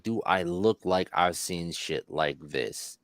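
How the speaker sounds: slow, low and dry, imitating the cop; deadpan cop. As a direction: let the question drop, flat and unimpressed. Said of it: "He was like".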